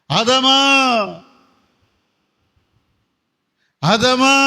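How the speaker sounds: calling out. Acted. God calling out for Adam.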